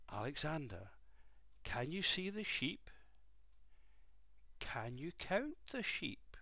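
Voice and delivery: quiet, gentle asking. Acted.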